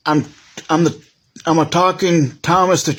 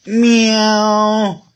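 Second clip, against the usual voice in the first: cat meow; 4 semitones higher than usual. Pretend. Toy cat voiced in play.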